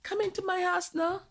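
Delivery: high, soft put-on Jamaican lilt; a Jamaican mother inviting the son's visitor, mock Jamaican accent; fake Jamaican accent. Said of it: "my fake Jamaican accent".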